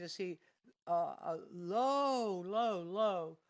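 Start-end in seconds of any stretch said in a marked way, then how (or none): 1.2-3.4 slow emphatic teaching voice; drawn out. Shown as a demonstration. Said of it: "teacher draws out low".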